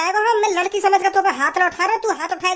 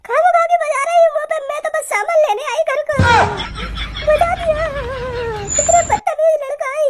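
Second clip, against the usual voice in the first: put-on much higher, complaining girl's voice; whiny girl; 8 semitones higher than usual. Girl complaining to the uncle about rude boys, in a high whiny voice. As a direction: whine like a wronged girl with a laugh breaking through.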